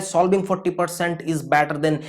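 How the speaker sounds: each word laid out slowly like a motto; slow mantra. Shown as a demonstration. Teacher giving students an exam mantra, slow and deliberate. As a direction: spell out the motto slowly so it sticks. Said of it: "यही दो मंत्र".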